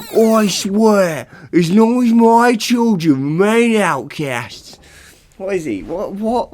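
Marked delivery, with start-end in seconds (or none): 0.1-4.8 put-on high, loud vengeful god in a wobbly accent; loud, high Hades curse with an accent; odd accent. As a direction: curse them in a slipping accent. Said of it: "what is that accent".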